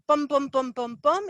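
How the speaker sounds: short punchy "bum" beats, the last one jumping high; staccato bums. Shown as a demonstration. Showing how the fast buyer's speech comes right at you, in staccato beats. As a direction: hammer out each "bum" like a drumbeat. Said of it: "It's kind of staccato and it comes right at you".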